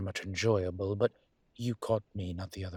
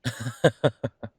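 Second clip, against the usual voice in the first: loud bright laugh; laugh, delighted by the cheek; 4 semitones higher, 8 dB louder than usual. Acted. Demon amused by the student's teasing, a loud laugh.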